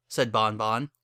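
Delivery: plain reading voice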